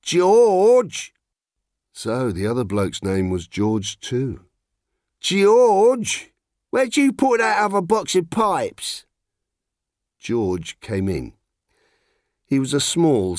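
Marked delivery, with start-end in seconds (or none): 0.0-1.1 high, loud call to the back of the shop; loud London call, much higher. A narrator's reading. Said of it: "and called". 5.2-9.1 high, loud call to the back of the shop; loud London call, much higher. A narrator's reading. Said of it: "and called".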